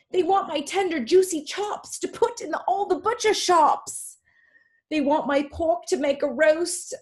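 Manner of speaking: put-on high-pitched, agitated pig with a British accent. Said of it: "I can only read this in a British accent".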